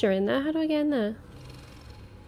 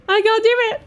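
high, loud panicky whimper; frightened whine; 12 semitones higher, 11 dB louder, faster than usual. Real, not acted. Player facing a monster, high panicky whine. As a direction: plead like you want to quit.

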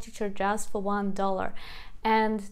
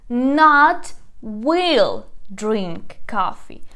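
not stressed, each word slow and loud; slow literal gloss; 8 dB louder, much slower than usual. Shown as a demonstration. Teacher spelling out the literal word order, slow. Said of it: "pay attention".

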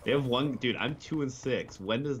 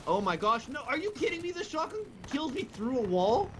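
high-pitched disbelief; incredulous; 13 semitones higher than usual. Real, not acted. Gamer killed by a shotgun through a wall, incredulous. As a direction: pitch leaps up in real disbelief.

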